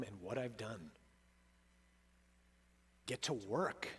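a hushed stern command from above; the Lord's voice. Acted. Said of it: "resounding thing from the Lord".

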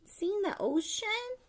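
usual speaking voice

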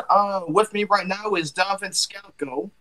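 ordinary talk

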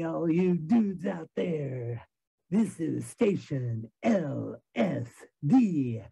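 slow, drawn-out groovy radio host. Pretend. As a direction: hype up the listeners like a sixties DJ. Plays a radio DJ, the Sandman.

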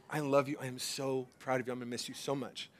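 soft, low fatherly affection; tender. Retold or imitated. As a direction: murmur it lovingly to a son, re-enacted. Voicing tender parting words to son.

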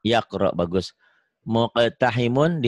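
ordinary delivery

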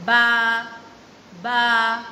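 loud drawn-out baa; sheep baa. Shown as a demonstration. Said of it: "A sheep says".

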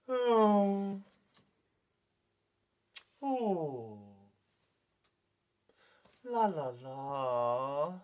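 mock-sad falling sighs, slowing down; sad Mister Horse. Leader voices sad horse for children, mock-mournful. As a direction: let each vowel droop mournfully. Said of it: "when Mister Horse is very sad".

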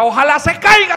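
high, loud scolding mother; a mother wishing the kid falls, loud and high; mock angry mom. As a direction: snap it up high like a fed-up mom. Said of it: "Y la cucha".

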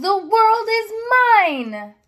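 high, triumphant exclaim. Acted. Dog on the mountain exclaims high and proud. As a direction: claim the whole world with delight. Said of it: "he exclaimed".

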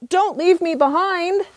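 high loud desperate plea; pleading cry. Acted. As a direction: call after them, high and loud. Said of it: "he cried out".

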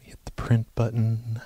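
whisper. Real, not acted. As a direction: whisper it so the caller can't hear. Whispering an aside while on call.

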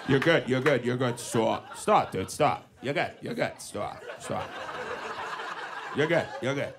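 low-pitched, repetitive parking calls; low mock traffic-director patter; guiding a driver in. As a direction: imitate a guy talking a car backwards. Voicing Jerry directing the parking.

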